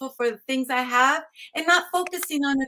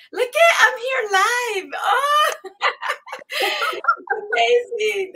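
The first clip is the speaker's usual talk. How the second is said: tearful excitement; 9 semitones higher, 5 dB louder, slower than usual. Real, not acted. Guest overwhelmed with gratitude, live.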